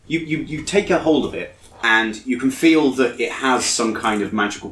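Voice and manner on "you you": ordinary talk, nothing marked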